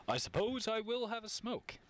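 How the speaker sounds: high, sustained mock-announcer swoop; playfully irritating announcer delivery; hammed-up announcer. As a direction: draw it out in a put-on announcing voice. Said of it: "That's gonna get really irritating".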